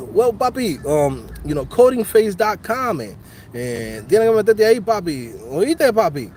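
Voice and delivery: put-on Dominican accent. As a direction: hype the site like a Dominican uncle with a Dominican accent. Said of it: "with a Dominican accent".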